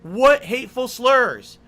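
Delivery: loud, slow indignation; explosive rage. Real, not acted. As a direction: demand it, furious. Outraged at accusation.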